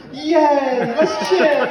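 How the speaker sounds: loud, high celebratory cheer; rally the crowd with a big yay. Real, not acted. Host rallies crowd to cheer.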